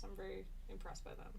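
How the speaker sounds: normal talking voice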